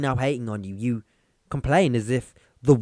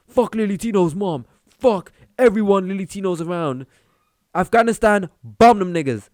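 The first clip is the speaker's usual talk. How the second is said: a lightly voiced troll ranting, higher and edgy; mock angry tweeter; 6 semitones higher, 5 dB louder than usual. Mocking his own imagined Twitter rant, in a hammed-up troll voice. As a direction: parody the hateful tweets he would never send. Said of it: "going on Twitter going".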